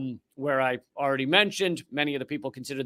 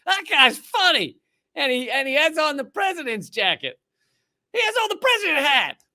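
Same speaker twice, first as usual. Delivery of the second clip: put-on thick Southern accent; 9 semitones higher than usual. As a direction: ham up a yokel Southern voter, pitched way up. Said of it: "thick Southern accents".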